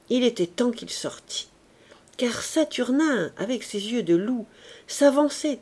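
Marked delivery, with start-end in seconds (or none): none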